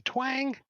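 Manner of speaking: high-pitched sung-out twang; bowstring twang. Acted. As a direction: imitate a bow being fired. Imitating bowstring sound of the shot.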